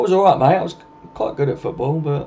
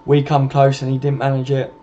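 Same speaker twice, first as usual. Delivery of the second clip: ordinary delivery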